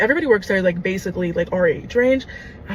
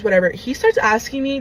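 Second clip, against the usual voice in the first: normal talking voice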